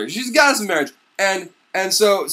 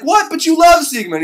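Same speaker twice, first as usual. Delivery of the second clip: shocked; 10 semitones higher, 6 dB louder than usual. Retold or imitated. Brunhild shocked.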